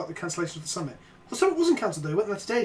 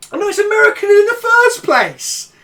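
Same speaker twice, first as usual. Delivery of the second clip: high, loud cry of defeat; dismayed realisation; 12 semitones higher, 9 dB louder than usual. Real, not acted. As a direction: cry out the bad news. Discovers show is American.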